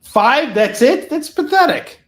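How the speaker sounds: loud, high scoffing disbelief; mock outrage. Real, not acted. Host teasing his cameraman about the count, mock outrage. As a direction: scoff at the tiny number. Mocking the low F-bomb count.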